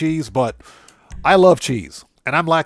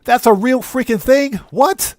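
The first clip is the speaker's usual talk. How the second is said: higher and louder, rising in astonishment; can't believe what you're seeing; real shocked What?; shocked disbelief; 8 semitones higher, 5 dB louder, slower than usual. Real, not acted. Shocked by search result.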